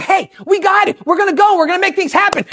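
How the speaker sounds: mock eagerness to get going; eager. Disciples finally ready to get to work, eager. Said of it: "they got this new attitude and they're ready".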